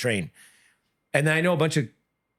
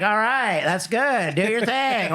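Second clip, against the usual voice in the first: higher, pushed coach voice; impression of dad coaching from the corner; annoying corner voice; 9 semitones higher, slower than usual. Daughter mimicking dad in the corner, annoying coach voice. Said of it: "Do the impression".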